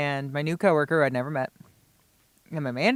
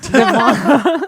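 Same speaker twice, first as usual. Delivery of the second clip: laughing; 9 semitones higher, 13 dB louder than usual. Real, not acted. Laughs after blurting a name.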